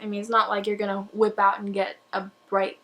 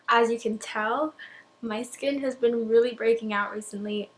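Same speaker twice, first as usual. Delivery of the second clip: ordinary talk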